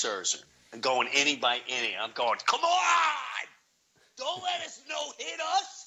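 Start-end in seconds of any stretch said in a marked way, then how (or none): 2.4-5.8 yelling at the TV. Retold or imitated. Fan yelling at the Mets on TV, high shout. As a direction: cheer the team on hard, pitched way up.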